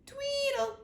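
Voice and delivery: high, sing-song bird chirp; chickadee song. Acted. Chickadees singing to the morning, high chirp. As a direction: chirp it out like a little songbird. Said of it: "when the chickadees sang".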